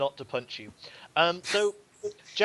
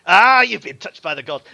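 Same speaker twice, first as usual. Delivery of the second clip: loud gruff bandit boss; Scarface voice; 4 semitones higher, 16 dB louder than usual. Acted. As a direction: bark it like a thug leader. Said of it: "Scarface goes".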